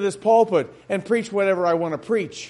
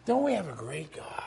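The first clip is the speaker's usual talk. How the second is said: whispered, much softer repeat of "Don't we have a great God?"; 5 semitones lower, 11 dB quieter than usual. Shown as a demonstration. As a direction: breathe the question in awe. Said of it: "repeated as whisper".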